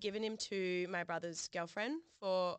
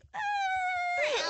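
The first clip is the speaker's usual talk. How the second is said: loud shrieking laugh; helpless laughing squeal; high squealing laugh; 9 dB louder than usual. Real, not acted. Laughing at own mistake.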